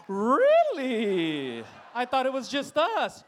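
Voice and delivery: loud, high, slow mock surprise.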